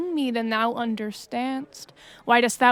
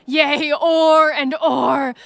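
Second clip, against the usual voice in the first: slow, loud, ringing vow; defiant shout; 2 semitones higher, 6 dB louder, slower than usual. Acted. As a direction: hurl the threat out slowly. Hector's threat to Achilles peaks.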